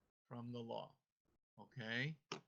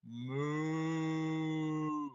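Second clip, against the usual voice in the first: long, loud cow moo; moo sound; 7 dB louder than usual. Shown as a demonstration. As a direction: lead the group with a drawn-out moo. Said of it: "the moo sound".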